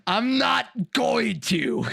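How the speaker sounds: high, slow put-on femmy voice; mock denial. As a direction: drag out a high playful protest.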